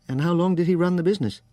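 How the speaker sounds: put-on fast, high question in light English accent; slightly English accent. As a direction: ask quickly as a California Brit. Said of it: "His accent was hard to place, something English in it".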